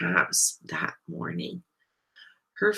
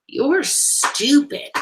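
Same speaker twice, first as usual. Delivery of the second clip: high, loud, drawn-out jabs; punchy childish jeering; rude insults; 8 semitones higher, 7 dB louder, much slower than usual. A narrator's reading. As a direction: hurl insults like a mean schoolkid. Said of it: "the rude insults".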